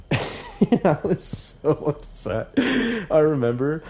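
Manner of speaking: laughing. Real, not acted. Laughter carries on.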